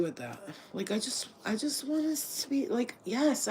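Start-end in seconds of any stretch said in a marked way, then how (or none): none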